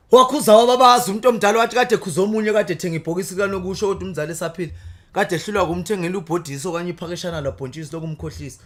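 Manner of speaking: loud declaimed praise poetry; poem recitation. Acted.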